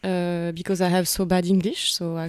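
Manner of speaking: heavily French-accented English; self-mocking bad English in a French accent. Shown as a demonstration. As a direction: speak halting English with a strong French accent. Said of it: "I have a really bad, euh, English accent".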